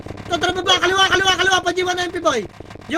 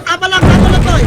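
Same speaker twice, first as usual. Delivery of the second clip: loud, urgent repeated call; excited shout; 3 semitones higher, 9 dB louder than usual. Real, not acted. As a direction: yell it over the chaos of the fight. Shotcaller shouting during team fight. (malakas at nagmamadaling sigaw)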